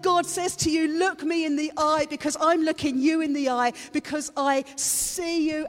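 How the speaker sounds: high, loud sermon delivery; fervent Easter preaching; passionate preaching. Acted. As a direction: drive the message home to the congregation. Preaching at full force.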